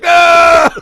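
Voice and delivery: high, loud scream of horror; dad's horrified shout. Retold or imitated. Dad caught with Ashley Madison on his phone, loud scream. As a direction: yell as if caught out. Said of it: "He's like".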